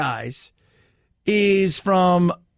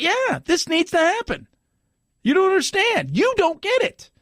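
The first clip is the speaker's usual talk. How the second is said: much higher-pitched mock exasperation; imitated heated insistence; 11 semitones higher than usual. Mexican immigrant argues the case to his table, high exasperated imitation. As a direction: act out a worked-up man insisting.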